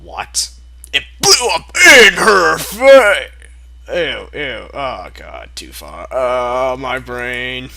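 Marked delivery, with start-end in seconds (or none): none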